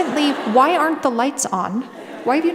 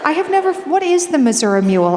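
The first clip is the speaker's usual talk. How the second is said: laughing disbelief; 5 semitones higher, 7 dB louder, faster than usual. Real, not acted.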